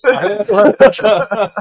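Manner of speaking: slow, high, laughing boast; giggling brag about the photos; laughing gloat. Real, not acted. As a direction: savor it through laughter.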